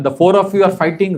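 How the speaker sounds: ordinary talk, nothing marked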